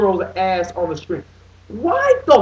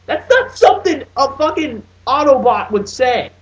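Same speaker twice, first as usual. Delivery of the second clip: normal talking voice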